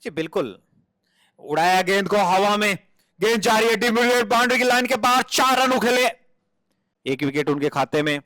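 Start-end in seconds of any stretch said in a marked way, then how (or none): 1.4-6.1 rising boundary call; excited cricket commentary. Acted. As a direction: lift for the four.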